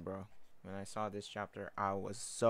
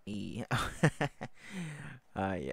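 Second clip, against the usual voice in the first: ordinary talk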